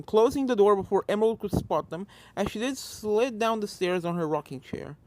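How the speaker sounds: plain reading voice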